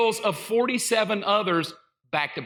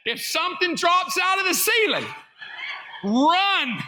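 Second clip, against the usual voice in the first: mock alarm; 8 semitones higher than usual. Mock warning to congregation.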